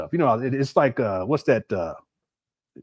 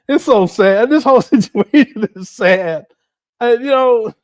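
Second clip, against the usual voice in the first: laughing; 9 semitones higher, 9 dB louder than usual. Real, not acted. Laughing through mock sadness.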